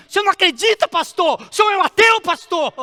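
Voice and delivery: high, incredulous cry; hammed-up pleading outburst, loud and high; dramatized desperate shout. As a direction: shout it at the pastor in acted disbelief. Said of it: "Como ele tá dizendo assim".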